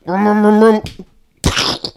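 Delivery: revving vroom, fading to breath; engine noise. Acted. Bus chasing the fleeing principal with engine revs. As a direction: chase him down with engine revs.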